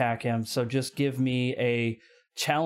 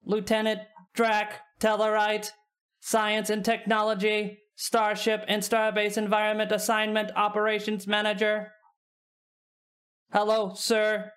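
robot voice; 7 semitones higher than usual. Acted. Robot reads personnel record.

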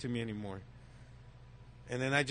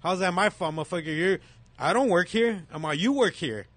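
higher, louder mock-furious comeback; retold angry comeback; 5 semitones higher, 4 dB louder than usual. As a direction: rip into the salesman, higher and louder. Retold argument, insulting dealer.

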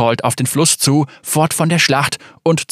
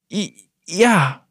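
stumbling, stammering start, higher; 6 semitones higher than usual. A narrator's reading. Maya stammering before the stern general. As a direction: catch on the first sound, then a higher ja. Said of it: "stammelte sie".

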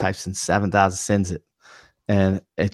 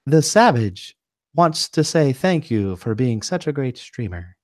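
slow, higher-pitched mock text-to-speech announcer; automated voice; 5 semitones higher, slower than usual. Reading out a donor's message to a streamer, imitated automated voice. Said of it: "an automated voice comes on and says".

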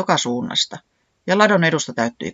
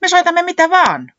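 very high, loud eager cry; excited exclamation; 7 dB louder than usual. A narrator's reading. Säämies answers the manager's music question, exclaiming. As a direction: burst out with it at the top. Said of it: "huudahti Säämies".

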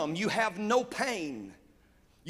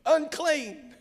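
high, called-out cry of Unclean; shouting a warning; 6 semitones higher, much slower than usual. Retold or imitated. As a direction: call it out to the street like a warning. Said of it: "somebody started shouting".

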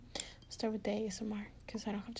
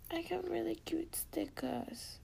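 high, drawn-out cutesy voice; cutesy gushing; 8 semitones higher, 4 dB quieter than usual. Real, not acted. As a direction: gush slowly, up high. Gushing about cute stickers.

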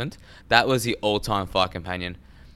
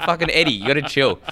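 loud, raised-pitch mock plea; exasperated retort; 6 semitones higher, 5 dB louder than usual. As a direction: tell him off, half laughing. Acted reply to the ranting impression.

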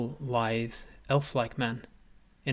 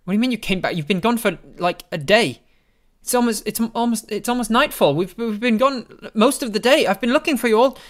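high, loud, exasperated mother voice; insist, near tears with worry; upset mother; 11 semitones higher, 12 dB louder than usual. Acted. Mother telling Apis he was gone all day, upset.

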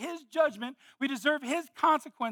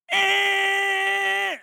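loud high nasal honk; honking horn imitation; 10 semitones higher, 9 dB louder than usual. Passing car honking at him, loud honk imitation.

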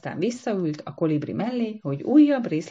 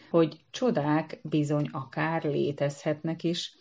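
usual speaking voice

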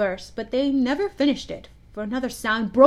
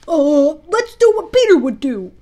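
put-on loud, higher boys' call; rally the others loudly; rallying cry; 5 semitones higher, 10 dB louder than usual. A narrator's reading. Boys agree on a plan.

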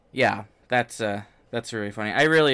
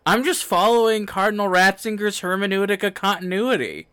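high, sarcastic put-on innocence; mocking voice; 8 semitones higher, 4 dB louder than usual.

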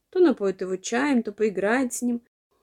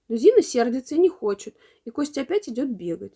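ordinary delivery

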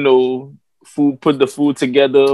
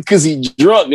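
ordinary talk, nothing marked

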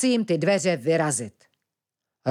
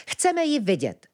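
higher-pitched, unyielding demand; insistent; 8 semitones higher than usual. A narrator's reading. Ventilek demands to see dušička, insistent. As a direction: refuse to back down, pitch raised. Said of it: "nedal se ventilek".

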